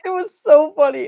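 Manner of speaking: high, loud, laughing through it. Real, not acted. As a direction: still cracking up while speaking. Laughing about the memory.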